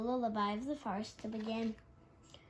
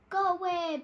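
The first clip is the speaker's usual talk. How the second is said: loud high pleading; begging; 8 semitones higher, 7 dB louder than usual. A narrator's reading. Rabbits beg the wolves to go away. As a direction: plead with them to leave. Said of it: "begged the rabbits".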